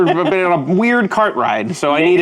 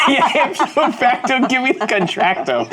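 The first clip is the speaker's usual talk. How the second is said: higher, giggling delivery; laughing repeat; 7 semitones higher than usual. Acted. Player repeating the table's rhyming joke, laughing. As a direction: crack up while repeating the joke.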